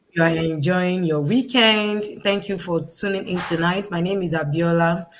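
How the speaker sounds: usual speaking voice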